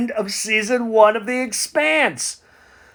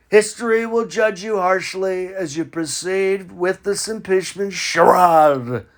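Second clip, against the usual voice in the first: ordinary delivery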